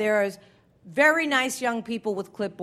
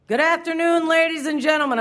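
higher, louder busker-style greeting; subway pitch voice; 6 semitones higher, 4 dB louder than usual. Retold or imitated. Subway performer greeting the car, high and bright. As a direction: ring out the opening like a subway panhandler. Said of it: "It's that pitch, that tone".

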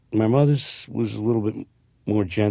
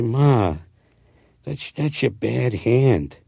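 louder, higher, slow and deliberate; acted scolding; 5 semitones higher, 7 dB louder, much slower than usual. Son tells his mother on set, louder and slow. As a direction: spell it out to her, raised and slow.

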